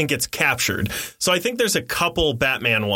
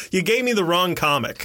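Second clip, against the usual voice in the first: ordinary delivery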